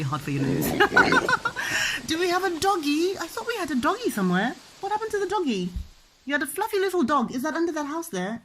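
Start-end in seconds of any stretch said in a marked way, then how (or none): 0.7-2.0 high, bubbling real laugh. Real, not acted. Mum amused by her own pig noise, laughing. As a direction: burst into high giggles.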